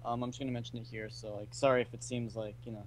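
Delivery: barely audible, far from the mic; really quiet. Real, not acted. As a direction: speak almost too quietly to hear. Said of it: "I can hardly hear you".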